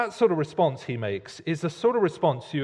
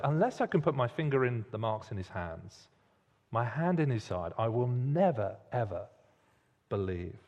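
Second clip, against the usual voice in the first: quiet restating; 5 semitones lower, 6 dB quieter than usual. Retold or imitated. Thomas's refusal, repeated softly.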